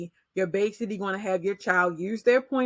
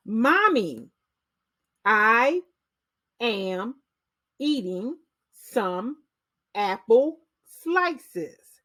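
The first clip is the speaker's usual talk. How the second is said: slow, word by word, a little higher; clear, unhurried reading to a child; slow fluent read-aloud; 3 semitones higher, much slower than usual. Shown as a demonstration. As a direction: read it slowly so a small finger can follow each word. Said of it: "You're going to read slow, but it will still be fluent".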